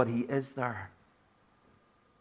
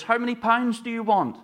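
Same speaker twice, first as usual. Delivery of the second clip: a raised, chirpy shopkeeper voice; lightly voiced butcher, pitched up to the dog; butcher's question; 9 semitones higher, 3 dB louder than usual. Preacher's joke, butcher asks the dog, lightly voiced.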